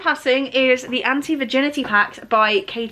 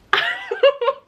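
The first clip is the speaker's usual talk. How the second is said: delighted laugh. Real, not acted. Delighted at Roxy's big gift.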